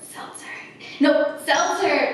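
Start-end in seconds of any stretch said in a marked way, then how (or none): 0.0-0.4 breathy whisper with nervous laughs. Real, not acted. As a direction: whisper it as a game rule. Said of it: "I have to whisper until my next".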